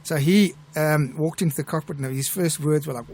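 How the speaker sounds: ordinary delivery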